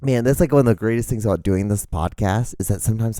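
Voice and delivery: ordinary talk, nothing marked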